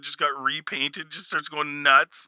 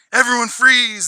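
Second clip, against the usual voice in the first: villain's order; 7 semitones higher, 7 dB louder than usual. Retold or imitated. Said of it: "and he's like".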